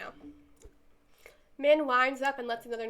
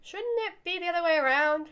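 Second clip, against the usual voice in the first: higher, questioning objection; taken aback; 5 semitones higher, 4 dB louder than usual. Acted. Answering an offer to sit in a lap, taken aback.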